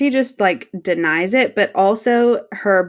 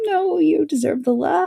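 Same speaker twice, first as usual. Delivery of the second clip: pleading; 8 semitones higher than usual. Retold or imitated. Pleading with character on rewatch.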